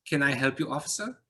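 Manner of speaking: lightly voiced Eastern European man, lifting high on officer; polite stranger with a hint of Eastern European accent. A narrator's reading. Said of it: "He had a slight accent, Eastern European".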